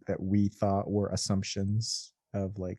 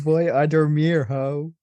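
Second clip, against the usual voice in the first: mock Spanish excuse; 4 semitones higher, 6 dB louder, slower than usual. Mocking Mike's excuse in Spanish.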